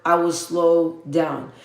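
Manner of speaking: slow, deliberate pacing. Real, not acted. Streamer answering a subscriber's complaint, deliberately slowed down. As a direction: say it slowly, word by word. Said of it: "I'm slowing down".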